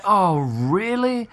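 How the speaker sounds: high, drawn-out incredulous question; exasperated disbelief. Retold or imitated. Film critic reacting to yet another bad choice, exasperated. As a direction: stretch it out in dismayed disbelief.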